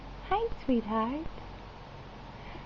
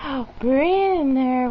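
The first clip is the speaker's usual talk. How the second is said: ordinary talk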